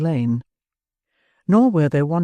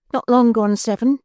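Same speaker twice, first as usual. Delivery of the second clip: put-on lifted, higher young woman's voice; Nancy's voice; 8 semitones higher, 4 dB louder than usual. A narrator's reading. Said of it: "said the girl".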